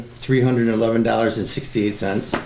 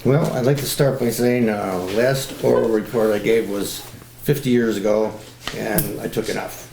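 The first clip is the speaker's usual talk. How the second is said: usual speaking voice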